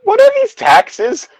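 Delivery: high, drawn-out puzzled voice; exaggerated innocent confusion; naive Harry. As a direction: ask it loud and high, like a baffled child. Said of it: "Harry listened intently".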